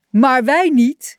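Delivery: roepend in koor. A narrator's reading. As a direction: call it out like a chorus of friends. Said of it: "riepen zijn vrienden in koor". (luid en langgerekt uitgeroepen)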